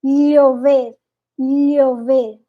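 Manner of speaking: "lhe ouvir" with a Portuguese lh sound; "lhe ouvir" said slowly, lh like the double L; Panamanian ll. Shown as a demonstration. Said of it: "que eles falam".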